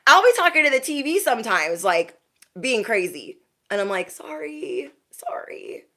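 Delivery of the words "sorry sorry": sheepish, hushed whisper; acted soft, voiceless apology. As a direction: whisper it like a guilty little sorry. Whispered apology after acted outburst.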